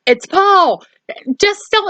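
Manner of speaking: mock yell. Podcaster retelling Annie calling Lindsay from the laundry room, high mock yell. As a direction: yell up high like you're stuck and calling for help. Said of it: "[name]'s yelling".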